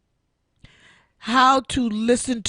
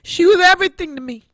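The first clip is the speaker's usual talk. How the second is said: choked up; 8 semitones higher, 6 dB louder, much faster than usual. Real, not acted. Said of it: "How can I get emotional now?".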